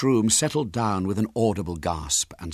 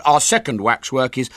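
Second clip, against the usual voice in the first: high, loud showman's call; big, high-pitched presenter voice; young showman William; 5 semitones higher, 7 dB louder than usual. Acted. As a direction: announce to the whole hall. William continues his show announcement.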